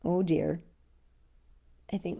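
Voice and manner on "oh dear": plain reading voice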